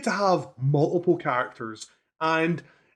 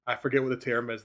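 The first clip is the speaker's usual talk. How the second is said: normal talking voice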